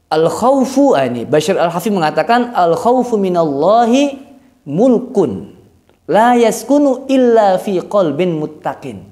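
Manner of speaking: ordinary talk, nothing marked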